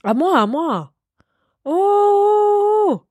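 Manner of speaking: high excited oh. Real, not acted. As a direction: burst in eagerly. Claiming her turn excitedly.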